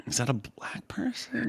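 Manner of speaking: hushed, breathy-quiet whisper; whispered question. Real, not acted. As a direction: whisper it under your breath. Asking about a picture shown.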